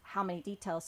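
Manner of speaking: usual speaking voice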